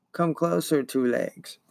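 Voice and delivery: soft, eerie swarm creature voice; buzzing monster voice. Acted. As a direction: coax the prey closer as a buzzing monster. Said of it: "buzzed the many voices".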